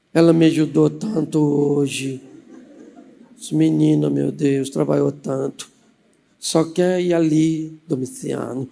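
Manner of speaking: low, slow, gentle wheedling; butter up a stern husband; sweet coaxing. Acted. Aunt wheedles husband for daughter.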